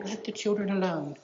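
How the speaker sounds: soft gentle version of the command. Shown as a demonstration. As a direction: say it softly, as a tender request. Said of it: "It's not a gentle".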